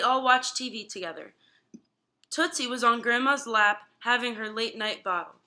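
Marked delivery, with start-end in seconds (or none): none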